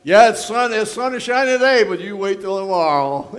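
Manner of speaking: mock-gloomy warning; mock pessimist. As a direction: play the doom-monger for laughs. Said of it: "Don't you love people like that?".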